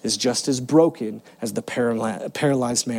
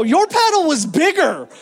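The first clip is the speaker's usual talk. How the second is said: much higher, laughing jab; laughing tease; 13 semitones higher, 4 dB louder than usual. Retold or imitated. Son teasing his dad about the paddle, laughing. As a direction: needle dad through a laugh.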